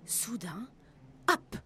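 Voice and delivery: hushed storyteller's whisper; suspense whisper. A narrator's reading. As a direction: narrator whispering as pirates creep aboard. Suspense narration.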